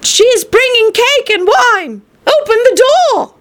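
high, sugary little-girl voice put on by the wolf; wolf in disguise pleading at the door, high; wolf faking the girl. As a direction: coax her to open up, sweet and high.

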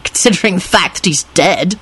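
understated spite; spiteful. A narrator's reading. Lupin points out he's dead, spitefully. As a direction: add it as a spiteful jab. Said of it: "Lupin replied spitefully".

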